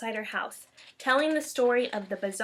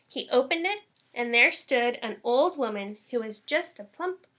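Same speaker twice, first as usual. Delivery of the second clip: plain reading voice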